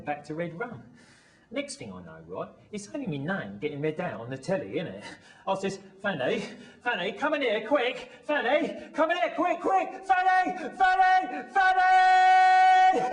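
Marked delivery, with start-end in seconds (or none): 6.0-13.1 urgent shouts building to a long yell; frantic, climbing holler for someone; excited calling out. Acted. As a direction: call to another room, ever higher and louder. Calling partner urgently to the telly.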